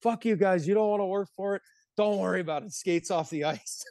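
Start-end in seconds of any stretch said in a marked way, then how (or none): 0.0-2.7 mock coach throwing a tantrum; angry snap. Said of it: "So Jelly eventually snaps".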